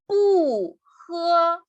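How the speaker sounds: "bu" falling from high, "he" high and level; original fourth tone on bu. Shown as a demonstration. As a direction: drop "bu", then hold "he" high. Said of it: "mantiene su tono original".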